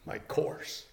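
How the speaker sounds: hushed reply to his own question; hushed answer. Shown as a demonstration. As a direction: breathe "My course." almost without voice.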